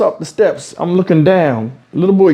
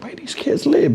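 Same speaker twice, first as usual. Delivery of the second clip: breathy under-the-breath question; whispered to himself; 7 semitones higher, 6 dB quieter than usual. Retold or imitated. Man on balcony wondering about kids, whispered. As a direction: whisper it so nobody below hears.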